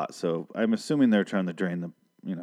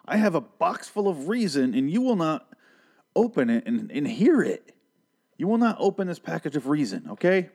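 pitched up, pleading mockery of Nick; frantic mock Nick; 6 semitones higher than usual. Recapper mocking Nick begging Luciana to leave, pitched-up pleading. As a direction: play him begging her to listen, voice climbing high. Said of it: "She's like".

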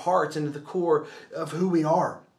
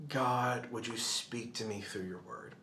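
hushed, much softer and lower; soft prayer; 4 semitones lower, 10 dB quieter than usual. Acted. Preacher voicing his prayer to God, hushed. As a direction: pray it almost under the breath.

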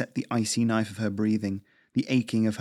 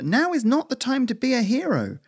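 high-pitched, alarmed plea; raised squirrel voice, pleading; urgent protest; 14 semitones higher, 4 dB louder than usual. Acted. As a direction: talk your partner down urgently. Pleading against risky plan.

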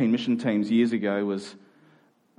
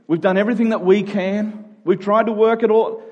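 rising, louder, fed-up listing of everything tried; mock exasperation; 8 semitones higher, 6 dB louder than usual. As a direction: ham up the exhausted last-resort attitude. Exasperated people resigned to prayer.

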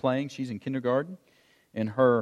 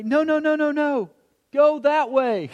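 high, loud calling across the field; acted sideline shout, much higher; urgent coach yell; 11 semitones higher, 6 dB louder than usual. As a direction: yell like a coach redirecting a kid. Coach calling to a kid running wrong.

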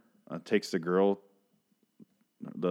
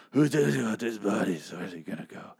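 mock character voice. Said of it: "it's just him going".

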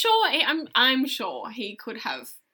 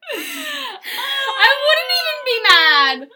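laughing squeal; 14 semitones higher, 8 dB louder, much slower than usual. Real, not acted. Laughing at the idea.

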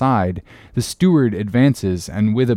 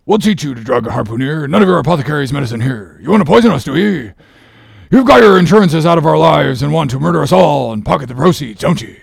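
threatening sailor; 4 semitones higher, 5 dB louder, faster than usual. Acted. Stubb threatens the steward.

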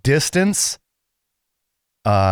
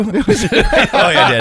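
laughing; much slower than usual. Real, not acted. Laughing at being teased.

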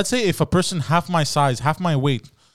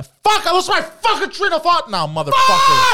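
loud, high-pitched outburst of frustration; exasperated shout; 5 dB louder than usual. Real, not acted. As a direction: blow up at losing your thread, screaming the swears. Interrupted outro, loses his thread.

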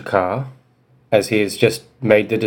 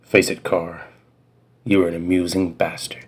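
lower, quieter, gruff-cool delivery; a slightly lower cool-guy read; 4 semitones lower, 5 dB quieter than usual. Acted. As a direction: drop low like a tough guy. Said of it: "let me go even lower in my register".

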